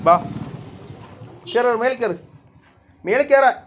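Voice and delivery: loud, high command to the cats; real shouted command; calling out orders. Real, not acted.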